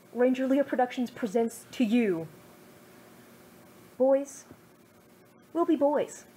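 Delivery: ordinary delivery, nothing marked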